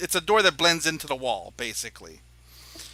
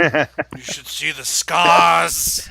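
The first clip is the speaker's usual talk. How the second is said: very slow, loud, heavy; ominous brag; 10 dB louder, much slower than usual. Acted. Innkeeper warning the players about his scars, very slow. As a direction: draw it out darkly.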